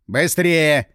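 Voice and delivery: loud hurry-up command; impatient order. Acted. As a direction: rush the secretary along loudly. Boss hurries the secretary.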